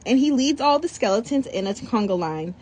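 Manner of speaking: ordinary delivery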